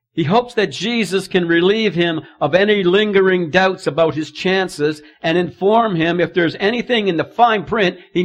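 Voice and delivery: loud, high pulpit preaching; preaching hard about pride. Acted. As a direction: drive the point home. Preacher at full force.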